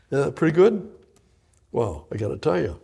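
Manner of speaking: ordinary delivery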